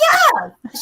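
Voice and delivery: high, breezy falling "Yeah"; mimicking the friend. Hostess brushing off the guest, high breezy yeah. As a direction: toss it off lightly, way up high.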